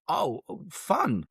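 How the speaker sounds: imitating an awkward, high-pitched reply. Pharmacist stumbling to find words for a customer, awkward. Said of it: "she must have felt equally awkward".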